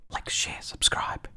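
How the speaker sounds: hushed, voiceless whisper; whispered plug. Real, not acted. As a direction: whisper it like a secret sign-off. Whispered plug after the song.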